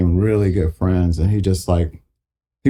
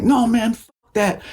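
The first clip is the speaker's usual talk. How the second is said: pitched-up refusal; high mock protest; 12 semitones higher, slower than usual. Musician refusing the producer's plan, pitched up. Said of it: "I'm like".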